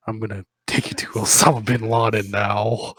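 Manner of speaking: announced loudly as a joke; hammed-up loud declaration; loud mock threat. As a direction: boom out the ridiculous plan. [name] voiced in story.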